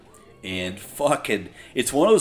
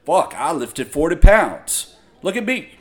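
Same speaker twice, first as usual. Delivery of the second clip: mock showboater; 6 semitones higher, 10 dB louder than usual. Mocking gym showboater.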